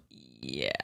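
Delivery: very low, soft yeah; deep goofy voice. Pretend. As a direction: drop into a bass yeah.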